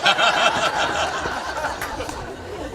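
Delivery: breathy, unvoiced laugh at the punchline. Real, not acted. Laughing after the joke.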